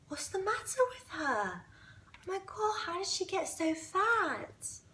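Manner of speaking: high, soft, feigned concern; innocent girl act. Retold or imitated. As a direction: play the wide-eyed innocent. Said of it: "I just put on my innocent girl act".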